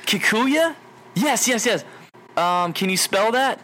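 high, eager "Kikuya? Yes, yes"; excited. Retold or imitated. Caller thrilled at the clerk's answer, high. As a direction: leap on the answer.

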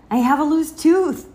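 put-on high, loud child's announcement; excited little girl. A narrator's reading. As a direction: announce it proudly to the seal. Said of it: "Sal said to the seal".

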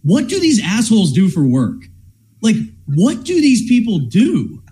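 high-pitched incredulous questioning; acted bafflement. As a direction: replay baffled disbelief, pitch jumping up. Acting out his baffled reaction on Fridays.

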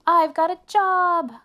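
loud, slow, high excited cry. A narrator's reading. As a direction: shout out the good news. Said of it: "cried Arthur".

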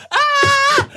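a high, loud shriek of laughter. Real, not acted. Cracking up over the catcall story, high shrieking laugh.

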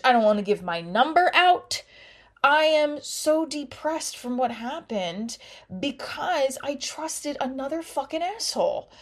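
upset, voice rising. Real, not acted. Upset outburst about being hurt.

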